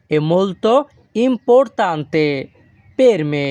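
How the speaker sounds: very slow, careful Italian sentence; slow Italian model. Shown as a demonstration. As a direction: drag importante out for learners. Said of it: "তখন আমাদের বলতে হবে কি?".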